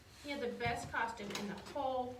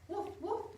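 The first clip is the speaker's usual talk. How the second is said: high, bouncy woof woof; high puppy bark; 7 semitones higher than usual. Acted. Dog sound in picture book.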